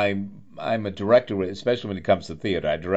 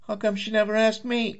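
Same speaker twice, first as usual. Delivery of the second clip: high, whiny, put-upon; a high sulky whine; mock plaintive whine. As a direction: pout it out like a jilted friend. Jokingly voicing own thought.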